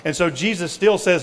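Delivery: usual speaking voice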